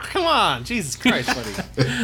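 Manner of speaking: ordinary talk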